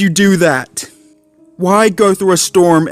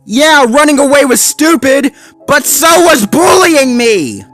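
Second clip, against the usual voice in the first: angry shout; 6 dB louder than usual. A narrator's reading. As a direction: scream out the betrayal. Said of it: "continued to huff and puff".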